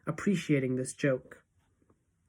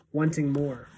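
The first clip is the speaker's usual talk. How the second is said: ordinary delivery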